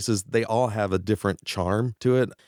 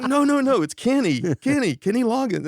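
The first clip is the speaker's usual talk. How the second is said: high, loud, urgent protest; 4 dB louder than usual. Retold or imitated. Singer mistaken for a prank caller, insisting urgently. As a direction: pitch leaps up, insisting on who is calling. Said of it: "I could hear the timbre in his voice".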